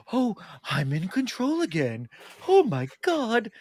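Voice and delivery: slow, rising to a high gasp of relief; mock relieved Doc Ock. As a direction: play the cured villain, amazed. Imitating Doctor Octopus cured.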